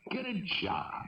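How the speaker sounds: high mock nagging; lightly mimicking a nagging mother. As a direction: parrot his mother's nag with a sneer. Mocking his mother's nagging.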